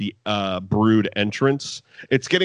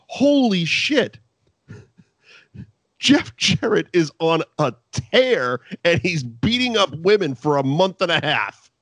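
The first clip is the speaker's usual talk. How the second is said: mock amazed; 5 semitones higher than usual. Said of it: "We didn't even think".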